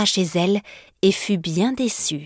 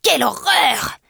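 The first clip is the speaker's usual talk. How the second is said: higher, shocked witch cry; startled; 7 semitones higher than usual. Acted. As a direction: recoil in horror, higher. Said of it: "sursauta la Baba Yaga".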